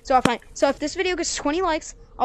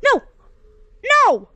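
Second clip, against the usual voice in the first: high loud cry of No; real gamer rage; frustrated yell; 11 semitones higher, 8 dB louder than usual. Real, not acted. Fails the jump again.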